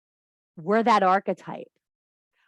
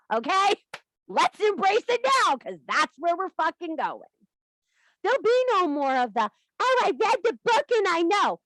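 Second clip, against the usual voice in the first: fired-up rant; 7 semitones higher than usual. Real, not acted. Fired-up rant, high pitch.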